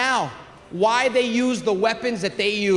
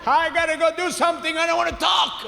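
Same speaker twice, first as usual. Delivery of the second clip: nervous outburst; 9 semitones higher than usual. Retold or imitated. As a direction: blurt it out like a jittery dad. Father nervous, retold again.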